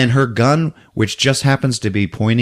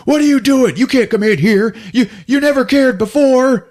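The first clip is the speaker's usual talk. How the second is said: panicked stepfather; 11 semitones higher than usual. Acted. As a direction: shriek at the intruder. Stepfather confronts Lou.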